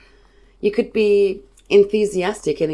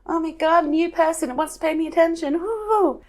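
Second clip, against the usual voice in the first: much higher, fast, bouncing with glee; excitable puppy; 12 semitones higher than usual. Pretend. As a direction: gush like a puppy meeting a new person. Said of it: "We've got a puppy who's excitable".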